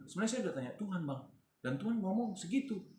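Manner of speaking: fast, high explanation; rushed. Retold or imitated. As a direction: rattle it off quickly. Rapid retold explanation.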